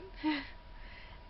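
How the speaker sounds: soft airless laugh; quiet embarrassed laugh; breathy laugh. Real, not acted. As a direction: laugh under the breath at yourself. Laughing at her own embarrassment.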